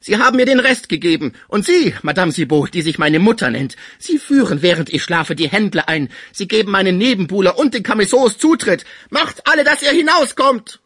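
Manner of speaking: high, loud ranting fury; furious. A narrator's reading. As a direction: drive them all out. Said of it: "seines Zornes und seiner Furcht".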